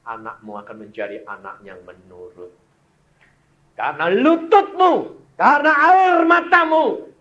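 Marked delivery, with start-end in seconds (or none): 3.8-7.1 high, loud shout of a preacher; fervent preaching shout. Acted. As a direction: cry it out to the whole church. Preacher exhorting the women.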